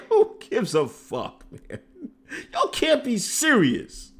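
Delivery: slow, laughing disbelief; laughing retort. Retold or imitated. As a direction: laugh it off, can't believe it. His own laughing reply.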